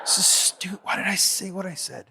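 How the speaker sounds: a hushed, breathless whisper to himself; whispered self-reproach. Retold or imitated. Peter cringes over his blunder, whispering to himself. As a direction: whisper it like you're cringing at yourself.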